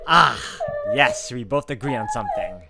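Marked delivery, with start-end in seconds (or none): none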